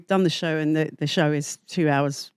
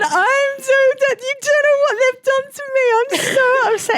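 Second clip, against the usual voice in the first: hammed-up wailing; 4 dB louder than usual. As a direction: imitate yourself as a hysterical mess. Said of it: "cried my eyes out".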